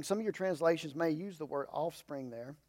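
ordinary delivery

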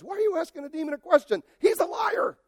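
high, fast, shouted rebuke; mocking outburst. Preacher scoffs at people questioning demons, high shout.

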